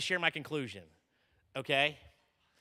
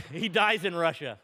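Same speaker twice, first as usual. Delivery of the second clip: laughing; 3 semitones lower, 5 dB louder than usual. Real, not acted. Laughs while telling.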